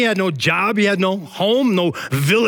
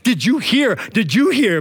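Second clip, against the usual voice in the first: raised, excited calling out; gleeful shouted announcement; mock rooftop shout; 5 semitones higher, 3 dB louder than usual. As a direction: call it out like news from a rooftop. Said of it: "I'm just gonna shout from the rooftops".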